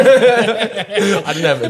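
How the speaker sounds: high-pitched, slow through laughter; laughing. Real, not acted. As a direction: laugh while explaining yourself. Laughing at his own absurd story.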